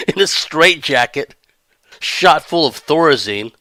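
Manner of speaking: usual speaking voice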